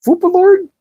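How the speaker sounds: "Foopalord" in a high, mocking, rising squeak; incredulous question. Shown as a demonstration. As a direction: react to "Foopalord" like it can't be real. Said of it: "This is".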